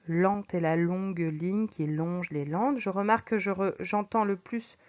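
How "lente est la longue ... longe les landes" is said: even slower languid take. Shown as a demonstration. Teacher repeats the line to stress the slow L. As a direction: lower and slow, "landes" drawn out with the L lingering. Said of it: "langueur, de lenteur".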